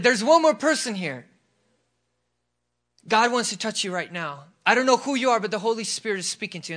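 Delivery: re-enacted forceful proclamation; bold altar call. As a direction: call out across the congregation. Re-enacts calling out from the pulpit.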